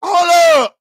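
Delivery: high, loud startled yell of hold up; shocked shout. Real, not acted. Streamer reacting to a shocking line, startled loud yell. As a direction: jolt up and shout hold up, stunned. Shocked by what he just heard.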